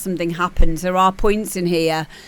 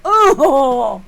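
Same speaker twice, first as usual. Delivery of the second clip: much higher and louder crying; mock sobbing contestant; 8 semitones higher, 7 dB louder than usual. Contestant overwhelmed by the judge's praise, mock sobs. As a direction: ham up a tearful breakdown.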